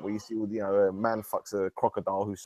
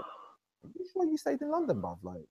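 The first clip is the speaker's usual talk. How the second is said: much higher, mock-disbelieving question; incredulous retort; 9 semitones higher than usual. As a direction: act like you can't believe your ears. Retorting to Dutch student's claim.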